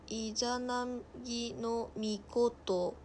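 イザナギのミコト drawn out slowly, syllable by syllable; sounding out a name. Real, not acted. Streamer reading an unfamiliar god's name, slowly sounding out イザナギのミコト. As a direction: read イザナギのミコト haltingly, piece by piece.

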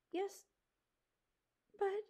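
soft, high, halting; timid faltering. Acted. Mouse questioning the porcupine, timid faltering. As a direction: hesitate between words, timidly.

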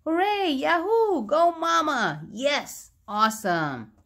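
high, loud, excited cheers; crowd cheering. A narrator's reading. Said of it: "Everyone cheered".

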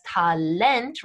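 "Talent" pronounced the German way, ta-LENT; final-syllable stress on Talent. Shown as a demonstration. Teacher citing English-looking German noun, "Talent" stressed ta-LENT. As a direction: slowly hit LENT at the end of "Talent". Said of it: "with that emphasis on the final syllable".

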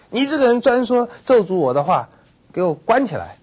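high, loud indignant command; furious outburst. Retold or imitated. Ruler raging at the forecaster who predicted rain, loud fury. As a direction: bark the order to lock him up. King rages at bad forecaster.

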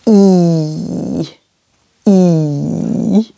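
low "E" held long as "ee". Shown as a demonstration. Teacher sounds the letter by itself, long low "E". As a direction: hold "E" as a steady, low "ee". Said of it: "is quite a long E".

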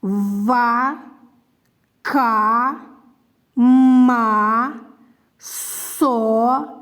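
each syllable stretched out and set apart; teacher modelling syllables for beginning readers; syllable reading. Shown as a demonstration. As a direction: read Ва, ка, ма slowly with long, even vowels. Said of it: "Читання складів".

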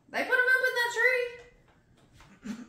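high, loud incredulous squeal; outraged disbelief. Real, not acted. Outraged reaction to the rhyme.

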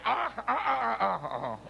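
put-on laughing baby babble; imitating toddler babble. Daughter answering on the phone, imitated babble. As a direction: babble back like a giggling toddler. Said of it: "Y ella".

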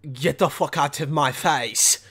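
mock French guy. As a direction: brush off the tourist in a low voice. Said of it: "the French guy's like".

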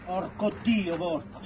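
normal talking voice

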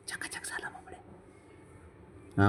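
slow, hushed whisper; whispered praise. Real, not acted. Owner praising the freshly bathed pet, in a whisper.

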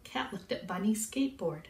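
ordinary delivery, nothing marked

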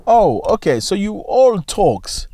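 drawn-out scornful "oh, okay"; mocking dismissal. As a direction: sneer that the threat was empty. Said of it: "You go like".

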